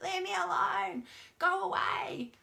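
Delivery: high, slow mock child pushing away; upset kid. Upset child telling the parent to go away, high mock plea. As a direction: play the kid shoving the parent off. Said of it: "they're like".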